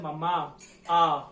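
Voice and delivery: Mama, then its final आ alone, loud; stress on final आ. Shown as a demonstration. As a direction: punch out the closing आ of mama. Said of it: "उच्चारण ज्यादा निकल रहा है".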